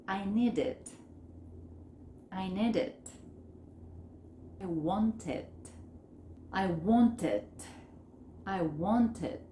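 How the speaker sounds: "I need it", "I want it" with final consonant joined to "it"; linked model phrases for repeating; linked, native-like. Shown as a demonstration. Teacher reading for students to repeat, words linked. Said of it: "Vamos a leerlas como native speakers".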